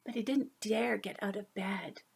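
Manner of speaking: usual speaking voice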